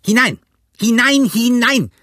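high, loud bellowed order; eager, angry, high-pitched yelling; furious roar. A narrator's reading. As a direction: roar at dawdling men to get moving. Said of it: "brüllte er".

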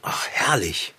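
breathy whispered sigh of bliss; whispering. Acted. Sighing over cozy tea.

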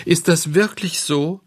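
high-pitched, tearful puppet question; mock sobbing. A narrator's reading. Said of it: "schluchzte der Hampelmann". (gespielt schluchzend, mit hoher Stimme)

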